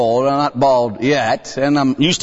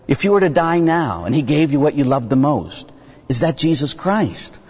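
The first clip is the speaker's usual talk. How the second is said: ordinary talk, nothing marked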